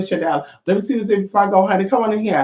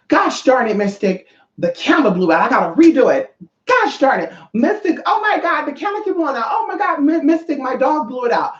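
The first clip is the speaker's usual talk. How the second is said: high-pitched, exaggerated panic quote; mock frantic clients; 8 semitones higher than usual. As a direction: imitate clients freaking out over a mishap. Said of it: "they go crazy".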